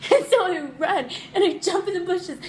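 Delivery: high, loud, laughing storytelling; real laughter pushing the voice up; cracking up. Real, not acted. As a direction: tell it barely holding back laughter. Cracking up as she retells climax.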